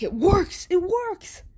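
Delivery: high, thrilled cheer of success; excited doctors. Retold or imitated. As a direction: doctors overjoyed the device works. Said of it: "the doctors are like".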